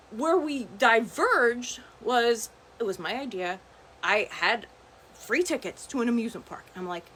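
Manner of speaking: ordinary talk, nothing marked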